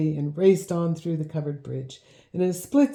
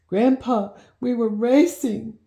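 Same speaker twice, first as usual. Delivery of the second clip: higher, louder sobbing plea; 7 semitones higher, 5 dB louder than usual. A narrator's reading. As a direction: sob it out. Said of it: "Gabe sobbed".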